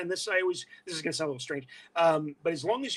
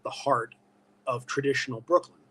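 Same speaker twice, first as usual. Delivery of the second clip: ordinary talk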